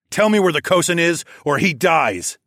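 forceful shouted threat; yelled threat. A narrator's reading. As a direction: yell an ultimatum at the enemy. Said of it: "I yelled".

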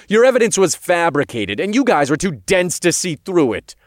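loud, high-pitched accusation; angry eruption. A narrator's reading. Hero attacks the fabricated evidence, erupting. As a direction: let the anger burst out. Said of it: "I erupted as I sat forward".

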